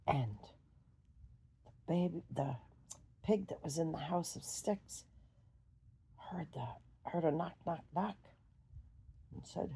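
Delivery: ordinary delivery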